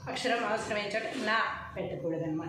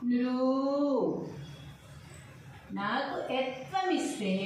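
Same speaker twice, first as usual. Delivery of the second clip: slow, deliberate syllable-by-syllable reading; slow recitation; 3 semitones higher, slower than usual. Acted. Teacher reciting the alphabet table slowly to students. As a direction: spell it out slowly for young pupils.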